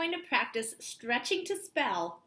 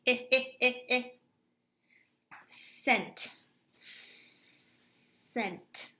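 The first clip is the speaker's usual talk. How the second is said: Scent whispered, then scent softly; whispered sounding out; 3 dB quieter, slower than usual. Shown as a demonstration. Teacher sounding out the last syllable, whispered. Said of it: "break them apart".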